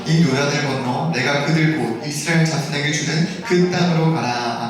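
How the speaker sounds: low, even recitation; scripture reading. Real, not acted. Pastor leading the congregation through the verse, scripture reading. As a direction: read the verse out for all to follow.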